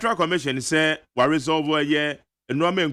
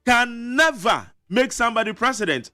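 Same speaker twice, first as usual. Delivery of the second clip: emphatic quote; 7 semitones higher than usual. Retold or imitated. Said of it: "Eti Hassan Ayarega na ɛka sɛ".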